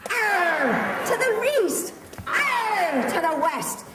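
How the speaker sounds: high laughing pirate shouts; pirate cry. Shown as a demonstration. Host leading the crowd in pirate cries. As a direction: growl Arr with a laugh. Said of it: "We're all doing a pirate cry".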